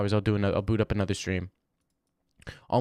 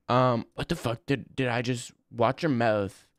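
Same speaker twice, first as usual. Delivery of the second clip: normal talking voice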